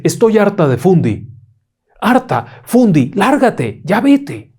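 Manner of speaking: high, loud furious banishment; exhausted mother yelling at the invisible Fundi, high and loud; fed-up mother. A narrator's reading. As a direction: shout the spirit out of the house. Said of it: "La mamá, tan cansada, dice".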